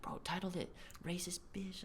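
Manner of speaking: whispering. Retold or imitated. Angry friend quoted.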